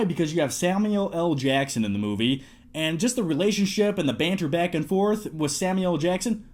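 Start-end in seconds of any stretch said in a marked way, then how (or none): none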